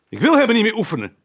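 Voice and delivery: loud, high, fast furious yell; shouting at his wife in a rage; exploding shout. Acted. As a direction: blow up and fire it out. Fed-up husband explodes.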